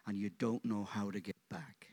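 whispered, soft and low; hushed whisper. Real, not acted. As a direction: pull them in with a whisper. Preacher drops to hush.

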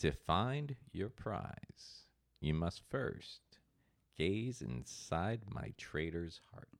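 very slow, low, hushed riddle; creepy clue reading. Acted. Host rereading the treasure clue, slow and creepy. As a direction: draw out each phrase ominously, softly. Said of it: "like, a creepy guy would say".

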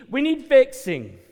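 need hit high and loud, falling; pleading cry. Real, not acted. Preacher pleading to the congregation, loud cry. Repeats plea, building.

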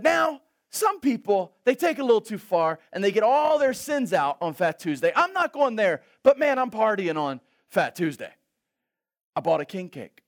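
high, loud, breathless rattling explanation; excited friend. Retold or imitated. New Orleans friend explaining Fat Tuesday, high and excited. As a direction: gush it out like it's your holiday.

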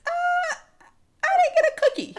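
put-on very high little-kid whine; squeaky mock-child voice; crying kid. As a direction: imitate a child upset over a treat. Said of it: "And the kid said".

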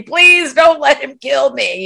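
high, drawn-out mock plea; mock begging for mercy. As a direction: plead for your life, hammed-up. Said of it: "he's asking for mercy".

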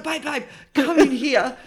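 high, laughing retold summons; imitated excited boyfriend. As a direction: call your partner in from another room. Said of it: "he's like".

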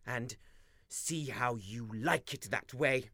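low, soft muttered threat. Acted. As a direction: mutter it darkly. Raymond's bitter muttered threat.

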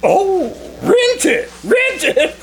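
can't believe his luck, gushing; overjoyed disbelief. Acted. Agent hearing of a free apartment, giddy with joy.

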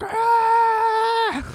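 very high short mock shriek; hammed-up high scream of fright. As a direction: yelp like a frightened kid, hammed up. Child screams at fire.

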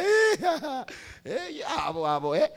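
high laughing burst. Real, not acted. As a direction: break into a laugh mid-sentence. Laughing at mimicked gossip.

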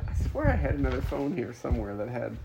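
talking through a face mask; muffled behind a mask. Real, not acted. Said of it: "You're sounding a little muffled".

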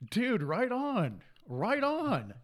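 high, drawn-out cheer of agreement; retold delighted approval. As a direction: stretch it out high, thoroughly impressed.

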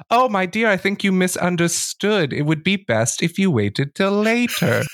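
high, sweet menace; high silky warning from the Countess; Countess's veiled threat. Acted. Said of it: "she says".

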